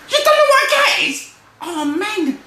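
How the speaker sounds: very high, incredulous mouse voice; squealing disbelief. Acted. Brother astonished Freddy knows no cats, high squeal. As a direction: shriek it, then sigh high.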